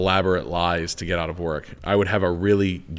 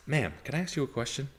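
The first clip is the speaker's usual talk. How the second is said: softer, higher, confidential ask; discreet polite question; 4 semitones higher, 11 dB quieter than usual. Retold or imitated. Salesman retelling his ask to an old customer, soft and polite. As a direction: gently lead in before a ball-bust.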